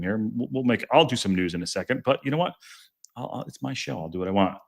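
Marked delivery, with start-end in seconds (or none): none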